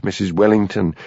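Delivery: ordinary delivery, nothing marked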